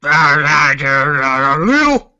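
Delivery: very slow, loud drawled aside; milk it for a laugh; hammed-up loud quip. Co-host's loud drawn-out quip.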